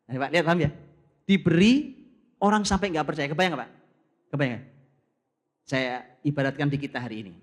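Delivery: normal talking voice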